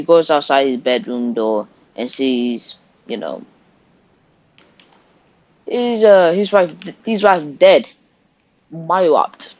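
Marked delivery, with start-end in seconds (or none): none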